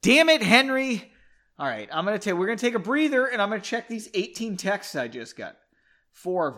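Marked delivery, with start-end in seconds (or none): 0.0-1.1 high, loud exasperated shout; acted cry of annoyance; angry outburst. A narrator's reading. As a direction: snap it out loudly in anger. Margot slaps Henry in anger.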